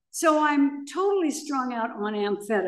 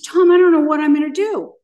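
loud, desperate cry to a friend; half-shouting in panic; recalling her panicked loud plea to Tom; hysterical plea; 5 semitones higher, 8 dB louder, faster than usual. Retold or imitated. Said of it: "I was completely hysterical and lost".

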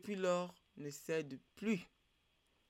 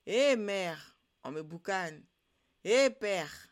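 loud "Eh" calls, easing between; calling out in distress; 2 semitones higher, 8 dB louder, slower than usual. A narrator's reading. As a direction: cry out for mother and father. Roasted fish calls out for help. (appels de détresse lancés fort)

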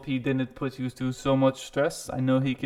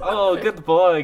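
praising a dog; 6 semitones higher, 8 dB louder, slower than usual. Acted.

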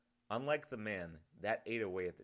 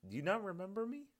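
put-on much higher, a young boy's puzzled question; young villager puzzled he isn't recognised, higher; 12 semitones higher than usual. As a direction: ask it like a hurt, confused kid. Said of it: "The boy looked puzzled".

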